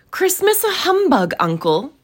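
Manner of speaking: high-pitched, disbelieving question; incredulous protest. Acted. Nephew questions his uncle's scoffing, incredulous. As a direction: start very high, astonished at the idea.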